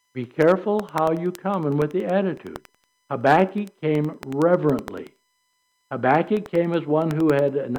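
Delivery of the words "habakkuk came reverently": usual speaking voice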